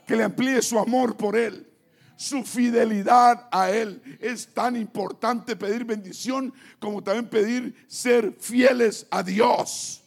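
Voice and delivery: loud, pounding preacher's exhortation; full-force preaching. Acted. Preacher warning the congregation to stay faithful, loud and forceful. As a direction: hammer the warning home to the church.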